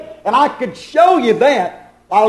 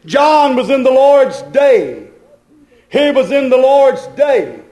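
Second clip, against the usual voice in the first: raised, measured preaching cadence; emphatic preaching; 5 semitones higher, 3 dB louder than usual. Real, not acted. Preacher explaining John's vision to the congregation, emphatic preaching. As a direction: drive each statement home from the pulpit.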